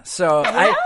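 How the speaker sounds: usual speaking voice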